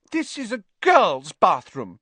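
high, loud suspicious objection. Acted. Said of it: "eyeing Ron and Harry suspiciously".

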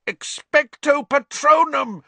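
high, full-force yell. A narrator's reading. Harry trying to blot out screaming, yelled. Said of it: "Harry yelled".

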